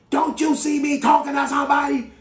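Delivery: high, loud outraged yell. Retold or imitated. Drive-thru teller snapping at a customer, yelling. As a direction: imitate the teller's indignant yell. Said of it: "she yelled at me and said".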